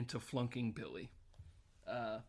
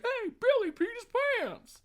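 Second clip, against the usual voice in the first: put-on much higher, loud childish tattling; squeaky kid calling it out; little kid voice; 6 dB louder than usual.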